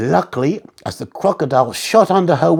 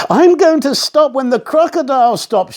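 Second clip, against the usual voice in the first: shouting; 7 semitones higher than usual. A narrator's reading. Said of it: "shouted Katie".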